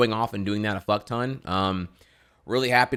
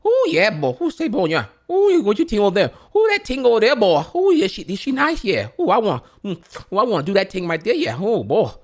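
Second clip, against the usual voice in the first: put-on much higher and louder, thick Cajun accent; exaggerated Cajun accent imitation, pitched way up; 12 semitones higher, 6 dB louder than usual. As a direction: ham up a small-town Louisiana Cajun man calling out to his buddy. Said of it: "that is what they sound like exactly".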